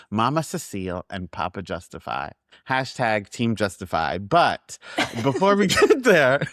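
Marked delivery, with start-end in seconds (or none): 5.2-6.5 higher and louder, breaking into laughter; can't get through it without laughing; laugh-broken line. Real, not acted. Laughs mid-phrase.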